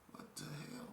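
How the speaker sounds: hushed, breathless whisper to himself. Retold or imitated. Alone in the dark, staring at the door: a startled whisper. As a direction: whisper it like you're scared of being heard.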